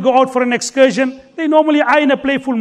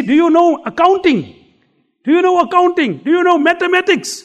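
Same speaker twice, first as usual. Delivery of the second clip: teasing students; 6 semitones higher than usual. Retold or imitated. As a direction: jeer at him, pitched up. Students teasing boatman louder.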